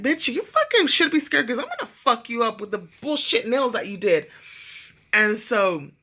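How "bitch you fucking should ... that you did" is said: tough talk through laughter; laughing mock threat. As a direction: threaten her while cracking up. Retells own threatening reply, laughing.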